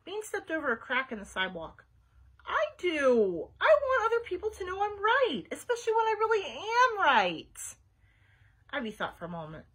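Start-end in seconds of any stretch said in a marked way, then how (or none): 2.4-7.8 high, loud, frustrated insistence; vehement. A narrator's reading. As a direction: protest passionately, pitched right up. Passionate disagreement about being right.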